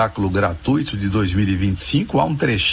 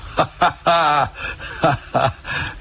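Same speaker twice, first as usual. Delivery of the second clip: real burst of amused laughter; hearty laugh; 4 semitones higher than usual. Real, not acted. Professor amused by the listener's musical challenge, hearty laughter.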